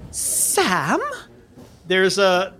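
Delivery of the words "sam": one name, drawn out as a question; questioning call. Acted. Old nun calls from the back of the mission, questioning. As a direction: call out as if unsure who it is. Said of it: "somebody in the back go".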